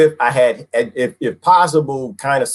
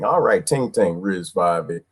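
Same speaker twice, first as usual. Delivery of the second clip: ordinary talk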